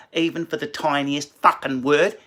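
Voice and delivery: put-on Australian accent stretched wider on "word"; bigger Australian accent. Comedian mocking Australians, broader Australian accent. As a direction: push the Australian vowels further, rising on "word". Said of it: "speaking like that are Australians".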